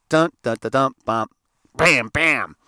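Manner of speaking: loud rhythmic knocking noises; imitated door knocking. Boys knocking on friend's door the old way, punchy imitation. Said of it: "We knocked on the door the way we used to".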